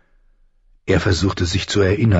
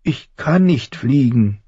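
high, slow whine; Bran whining; 6 semitones higher than usual. A narrator's reading. Frightened falling boy tells the voice no, whining. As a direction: draw it out like a whimpering child. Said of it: "greinte Bran".

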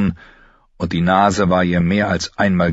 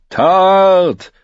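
shouted cheer, much higher and louder; crowd cheer; 12 semitones higher, 7 dB louder than usual. A narrator's reading. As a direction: cheer the name from the stands. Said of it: "Zuschauer bejubelten ihn mit".

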